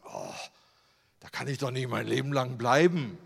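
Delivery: ordinary delivery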